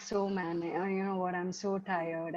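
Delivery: low, soft, worn-out mumble; tired sigh. Retold or imitated. Husband home from office, telling family he's tired, worn-out and soft. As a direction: sag into the words like a long day.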